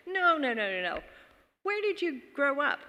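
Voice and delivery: high start, dismissing the answer; insistent friend. Retold or imitated. Friend rejects Kirkland and asks again, insistent.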